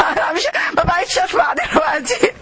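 high put-on voice, overcome by the chant; mock swooning fan. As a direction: play a listener too moved to hear. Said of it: "الناس اللي تذكر بصوت عالي".

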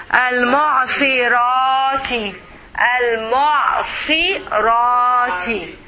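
"al-mu'sirati" slowly by syllables, the "ra" drawn out; long vowel. Shown as a demonstration. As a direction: stretch the "ra" in "al-mu'sirati". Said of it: "long vowel".

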